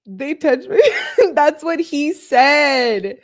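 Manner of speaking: high, loud, laughing disbelief. Real, not acted. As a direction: struggle to repeat it through laughter. Laughing while repeating the message.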